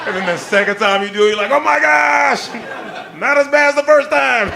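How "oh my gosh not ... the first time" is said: high, loud exclamation, hammed up; exaggerated gasping-at-the-news delivery; mock shock. Acting reaction to second murder.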